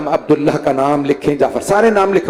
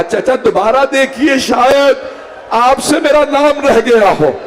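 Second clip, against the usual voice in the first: weeping plea; 8 semitones higher, slower than usual. A narrator's reading. Daughter begs uncle to check the list again, weeping. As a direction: beg through tears. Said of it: "बीबी ने एक मर्तबा रो के कहा".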